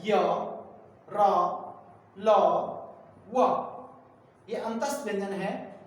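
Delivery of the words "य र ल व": य र ल व each alone, voiced straight from inside; semivowel consonants. Shown as a demonstration. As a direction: sound out य, र, ल, व as open semivowels, with gaps. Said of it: "अंदर से सीधे आवाज आती है".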